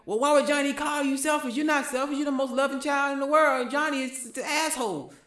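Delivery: high, sing-song coddling parent voice; mock-indulgent parent, ending on a laugh; doting parent. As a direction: gush over the child like a doting parent.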